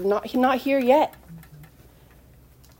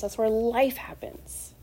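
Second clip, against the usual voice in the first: ordinary talk, nothing marked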